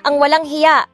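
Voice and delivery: plain reading voice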